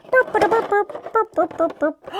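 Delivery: high, playful piggy grunts. Pretend. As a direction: grunt like a little pig.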